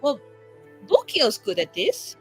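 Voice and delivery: usual speaking voice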